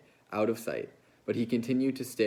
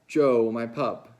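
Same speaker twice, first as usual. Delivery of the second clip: sad; 8 dB louder, slower than usual. A narrator's reading. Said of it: "he said sadly".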